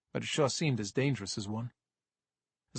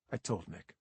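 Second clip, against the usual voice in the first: plain reading voice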